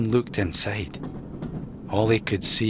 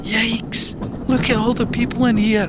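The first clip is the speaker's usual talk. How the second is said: much higher, louder startled raccoon; alarmed cry; 12 semitones higher, 3 dB louder than usual. Acted. Ian crying out to Mac at the crowded car. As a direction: jolt at the crowd. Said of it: "Ian cried".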